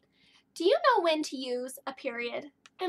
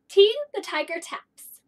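excited storytelling; 4 semitones higher, 8 dB louder than usual. Acted. Lively story for children.

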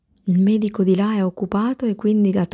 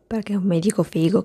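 ordinary delivery

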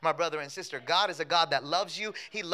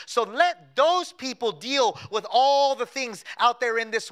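sermon voice building in strength; rising preaching; 4 semitones higher, 9 dB louder than usual. Acted. Pastor to his church about following Jesus, preaching with rising force. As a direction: preach it to the church, growing firmer.

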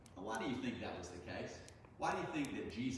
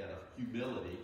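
ordinary delivery

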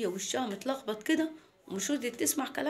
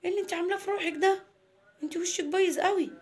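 louder, higher, astonished telling-off; shocked father; 8 semitones higher, 5 dB louder, faster than usual. Acted. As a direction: confront the daughter in surprise. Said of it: "قال لها". (أب مصدوم من بنته)

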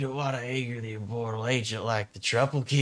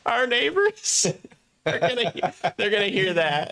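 high, breathless through laughter; laughing; 8 semitones higher than usual. Real, not acted. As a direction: squeak it out still laughing.

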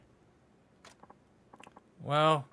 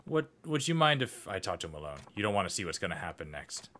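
usual speaking voice

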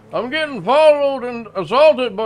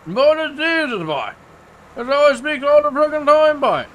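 put-on thick rural accent; 3 semitones higher than usual. Local insists this is how he always talks, thick rural accent. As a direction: lean hard on the rural accent and the boy.

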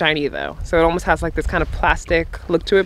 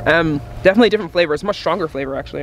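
talking between bites; chewing while talking; faster than usual. Real, not acted. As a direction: mouth partly full. Seen 3: holds bitten kelp piece at mouth, jaw working while tasting.

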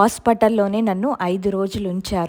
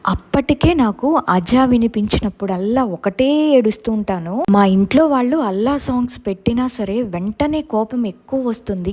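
usual speaking voice

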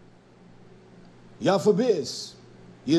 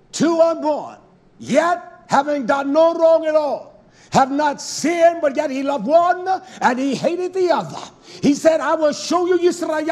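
preaching at full force; 5 semitones higher, 4 dB louder than usual. Acted. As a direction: drive it home like a revival preacher.

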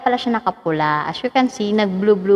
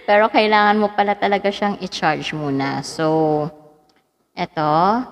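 ordinary delivery

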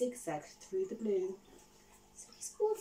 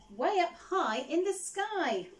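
lively teacher's call; 6 semitones higher, 5 dB louder than usual. Acted. Madam Dragon teaching flying.

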